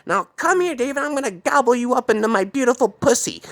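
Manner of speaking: high-pitched crazed creature woman; screech-like put-on high voice, hungry and mad; Sarah. Woman turning creature calls David over, high crazed voice. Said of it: "started turning into this freaking morphed creature".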